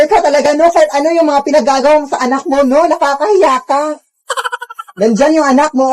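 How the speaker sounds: ordinary talk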